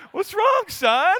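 high, loud mock retort to his son; mock shout. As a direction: throw it back at the kid, playfully loud.

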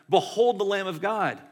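loud, high proclamation; proclaiming. Retold or imitated. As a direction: announce it to the crowd like John the Baptist. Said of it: "going like".

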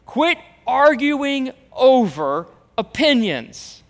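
loud, firm order to quit quarreling; forceful command. Acted. Preacher voicing Paul to quarreling church, loud and firm. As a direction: bark it out like Paul. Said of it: "He says".

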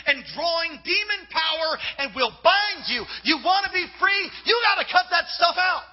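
high, loud shouted sermon; fiery preaching. Acted. Preacher warns youth about angry music, at full force. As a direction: preach it hard at the crowd.

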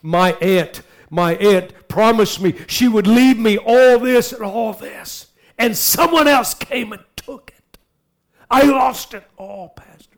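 high, distraught mock wail; hammed-up plaintive complaint, dying away at the end; imitating upset woman. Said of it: "I could tell she's emotionally upset, really troubled".

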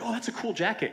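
high-pitched imitation of someone admiring; mock impressed stranger; imitated admirer. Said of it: "they're like".

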